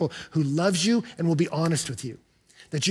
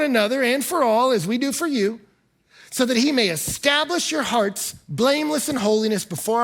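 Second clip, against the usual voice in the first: scripture reading; 4 semitones higher than usual. Acted. Pastor reading verse eleven aloud, raised formal reading. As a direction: proclaim the verse to the congregation.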